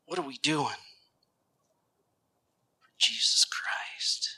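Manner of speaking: very soft, searching question; hushed challenge. Real, not acted. Preacher challenging the congregation, hushed and searching. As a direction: let it fall to a near whisper.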